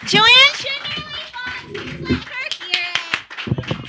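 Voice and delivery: distant offstage announcer voice; called from the back of the room; far-off host intro. Real, not acted. Host voice from afar introducing comic.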